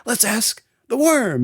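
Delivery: lightly voiced game show host. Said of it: "And so".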